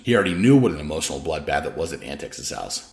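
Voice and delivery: plain reading voice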